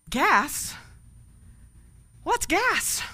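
high, puzzled voice of a clueless man; baffled man. Acted. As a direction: play it like he's never heard the word. Baffled man in told joke.

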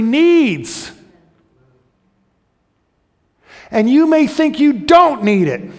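loud, high, impassioned preaching; fiery preaching. Acted. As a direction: build to a passionate climax. Preaching at full force.

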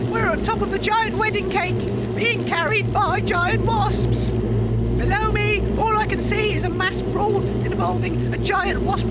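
shouted report. Acted. Reporter on a flying cake shouting to listeners above the brawl, loud. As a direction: shout the broadcast over wind and fighting. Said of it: "Dave, save your breath".

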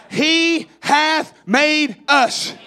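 he hath made us, shouted and very slow, every word hit; preacher pounding home a key truth; emphatic, drawn-out preaching. Shown as a demonstration. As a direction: hammer each word of he hath made us slowly. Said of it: "I'm preaching to you, young people".

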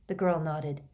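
ordinary delivery, nothing marked

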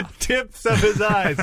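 high, slow squeaky echo; silly high voice. Acted. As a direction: squeak it back slowly. Repeats line in silly voice.

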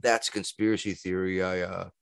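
hushed, half-joking disclaimer; quiet mock disclaimer. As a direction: back off quietly, tongue in cheek. Mock disclaimer to avoid being banned.

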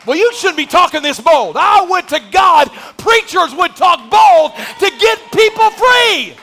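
high, loud, slow shouting sermon; preaching at full force. Real, not acted. Preacher warning church about pornography, full shout. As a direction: thunder it at them.

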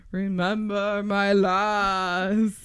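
put-on very slow, calm old wizard; Richard Harris's Dumbledore. Co-host showing the first film wizard they pictured, slow and calm. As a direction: draw each word out gently, like an aged headmaster. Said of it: "old first Dumbledore, Richard Harris".

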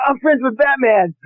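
high-pitched, giddy near-laughing delivery; giddy disbelief. Real, not acted. Actor marvels at playing Batman's friend, giddy and high-pitched. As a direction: barely hold back laughter, pitch shooting up. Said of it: "can't even say that without laughing".

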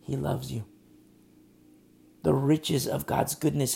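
usual speaking voice

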